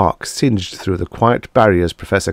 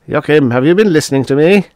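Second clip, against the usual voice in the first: a touch louder and higher, prodding; hearty Tanzler; 9 semitones higher, 7 dB louder, faster than usual. Acted. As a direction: call him back to attention. Tanzler's raised voice.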